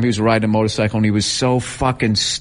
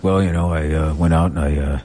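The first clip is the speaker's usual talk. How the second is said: dry and bored; 8 semitones lower than usual. Retold or imitated. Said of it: "telling him really dry".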